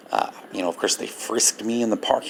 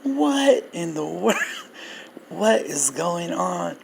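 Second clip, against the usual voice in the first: retold bafflement; 6 semitones higher than usual. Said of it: "everyone around was like".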